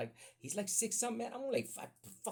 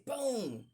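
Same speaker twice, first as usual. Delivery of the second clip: high loud boom; mock boom; 12 semitones higher, 5 dB louder than usual. Dixon hits the bully, loud boom. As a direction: punch the impact sound. Impact sound effect.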